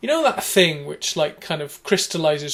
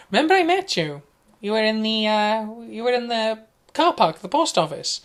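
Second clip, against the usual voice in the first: high, thin, reedy woman's voice; eager and thin, reminding him where they met; reedy caller; 6 semitones higher, 3 dB louder than usual. Retold or imitated. Imitating a woman reminding him where they met.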